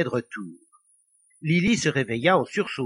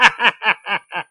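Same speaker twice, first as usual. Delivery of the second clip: loud, higher staccato laugh; gloating devilish cackle; 5 semitones higher, 9 dB louder than usual. A narrator's reading. Devil picturing the old lady falling, gloating cackle. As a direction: cackle at your own wicked plan.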